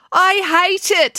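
high, loud, drawn-out revulsion; disgusted. A narrator's reading. April rejecting the pink makeover, loudly disgusted. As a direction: spit out each word slowly in disgust. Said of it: "said April in disgust".

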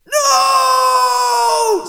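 long, high, very loud wail; agonised yell. Acted. Blinded Cyclops answering his neighbour, agonised yell. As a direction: bellow it in pain.

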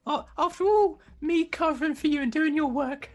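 high, loud squeaky reproach; wounded indignation. Acted. Trainee reproaching Ratterson for lack of loyalty, high and indignant.

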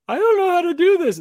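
put-on high, loud childlike voice; little daughter's voice. Daughter at the checkout with dad's card, high helpless child voice. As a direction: imitate a small girl at a loss. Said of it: "Amara goes".

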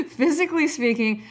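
"Physically speaking" said through a laugh. Real, not acted. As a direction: restart the line still giggling. Restarts while still laughing.

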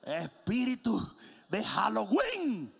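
slow, drawn-out rebuke; mock exorcism. Preacher rebukes the spooky toys, slow mock exorcism. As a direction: cast it out in comic alarm, slowly.

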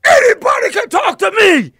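high, loud yelled imitation; imitating his fired-up shout; mock angry shout. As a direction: belt it like you're ready to fight.

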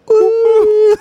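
high shrieking mock whoop; shrieking jackpot winner. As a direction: screech a celebratory woo. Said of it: "what it sounds like when".

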